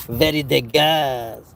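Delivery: slow, hammed-up Parisian accent; bad mock-French accent, stretched slowly; horrible Parisian accent. Shown as a demonstration. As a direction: draw it out in a badly faked Parisian accent. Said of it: "that's a horrible, uh, Parisian accent".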